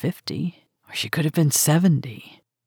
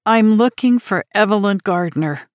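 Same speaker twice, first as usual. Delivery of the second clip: put-on raised, earnest young voice; eager American girl; young American woman; 6 semitones higher, 5 dB louder than usual.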